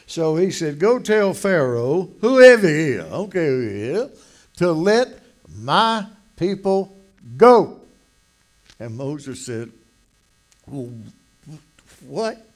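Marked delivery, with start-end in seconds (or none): 0.8-7.8 commanding God. Acted. God giving Moses a command. 10.7-12.5 halting, stammering mock stutter; imitated stutter. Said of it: "Did you know Moses stuttered?".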